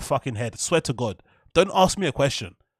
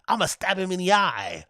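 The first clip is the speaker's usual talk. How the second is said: mock comedian questioning crowd; 3 semitones higher, slower than usual. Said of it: "he's asking you questions".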